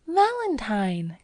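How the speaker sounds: high, drawn-out call of the name. A narrator's reading. Girl from the cab calls to her friend, crying out. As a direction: cry it out, lengthening the word. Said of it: "cried the girl".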